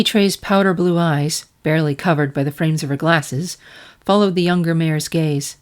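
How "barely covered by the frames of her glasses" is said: ordinary delivery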